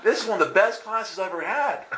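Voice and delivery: gushing with put-on delight; faked enthusiasm. Student lying to the teacher about the class, mock gushing. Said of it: "Well, I lied".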